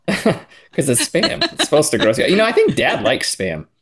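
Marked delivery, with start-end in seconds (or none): 0.1-1.4 laughing. Real, not acted. Laughing at sister eating Spam.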